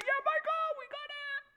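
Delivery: high, loud victory yell; victorious cheer. Acted. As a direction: shout it like you just won. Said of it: "get victorious and be like".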